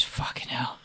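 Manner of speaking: hushed, whispered aside to a mate; retold muttered aside. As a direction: whisper it sideways, as if not to be overheard. Man confiding to his mate.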